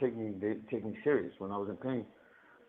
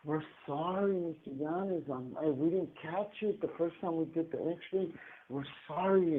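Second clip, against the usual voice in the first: soft, higher mock apology; mock their pitying excuses in a soft, raised voice; violin sob story; 5 semitones higher, 5 dB quieter than usual. Doctors apologizing for the missed diagnosis, mock sob story. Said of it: "came back with this little violin story".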